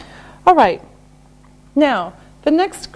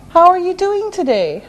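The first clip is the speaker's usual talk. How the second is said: quick, higher-pitched, connected question; natural brisk pace; 6 semitones higher, faster than usual. Shown as a demonstration. Teacher repeating the question at brisk natural pace. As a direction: run it together conversationally. Same question at faster speed.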